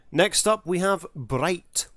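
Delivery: ordinary talk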